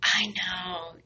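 a soft, breathless whisper. Real, not acted. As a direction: say it under your breath, just to him. Soft aside to partner.

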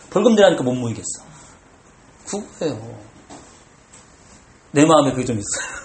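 normal talking voice

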